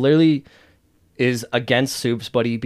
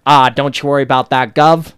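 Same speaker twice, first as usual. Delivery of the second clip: loud put-on character accent; Butcher; 2 semitones higher, 8 dB louder than usual. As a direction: punch out the mock accent, rising at the end. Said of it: "his fucking accent for Butcher".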